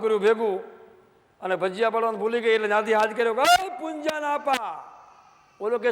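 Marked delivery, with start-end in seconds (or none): 3.4-4.8 high, slow drawn-out call to husband. Retold or imitated. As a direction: stretch the call across the yard. Wife calling out to husband. (પતિને ઊંચા, ધીમા લાંબા સાદે બૂમ)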